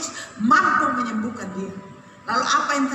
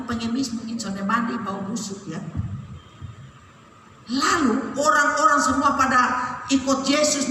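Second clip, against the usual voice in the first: ordinary delivery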